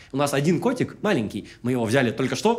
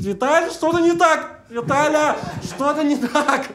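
panicked shout; 12 semitones higher, slower than usual. Retold or imitated. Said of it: "Она кричит".

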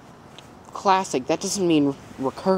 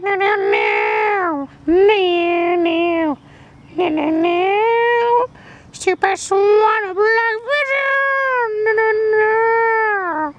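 drawn-out no, climbing higher and higher; slow rising panicked no; 13 semitones higher, much slower than usual. Acted. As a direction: panic as the vision fails.

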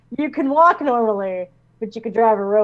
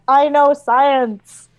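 slow, louder pompous proclamation; mock grand declaration; 7 dB louder, much slower than usual. To her committee, slow mock-pompous boast. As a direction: declare it slowly like a triumph. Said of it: "point it at my committee and be like".

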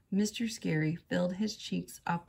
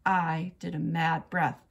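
ordinary delivery

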